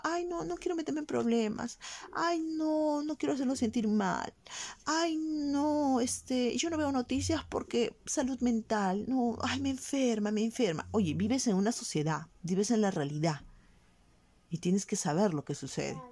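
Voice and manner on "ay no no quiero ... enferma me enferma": high, whiny mock voice of someone avoiding the news; whiny apathetic citizen. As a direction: mimic a pampered person who won't watch the news, pitched up. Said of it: "un pueblo que diga". (voz quejumbrosa y aguda, imitando con burla a alguien que evita las noticias)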